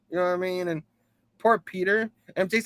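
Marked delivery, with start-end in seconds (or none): none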